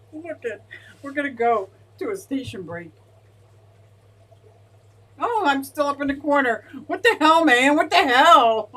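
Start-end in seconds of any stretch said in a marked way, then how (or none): 5.2-8.7 much louder and higher, an incredulous yell; shocked outburst. Real, not acted. Streamer spotting herself on screen, a shocked yell. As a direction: blurt it out in real disbelief.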